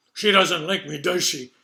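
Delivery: put-on slurred, cheeky old woman voice; gruff slurred voice. Judy restating her tease.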